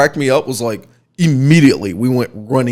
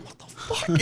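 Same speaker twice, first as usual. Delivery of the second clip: stunned whisper; 6 semitones higher, 12 dB quieter than usual. Retold or imitated. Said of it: "They're like".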